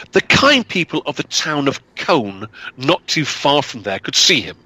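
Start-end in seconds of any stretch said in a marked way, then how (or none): none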